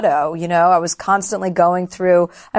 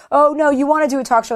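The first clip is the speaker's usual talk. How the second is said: mock coaxing; 7 semitones higher, faster than usual. Mimics being lured back.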